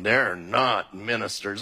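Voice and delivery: low, slow grumbling objector voice; mock objection. Pastor mimics congregants denying police are ministers, low slow grumble. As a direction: sulk it out as a doubting listener.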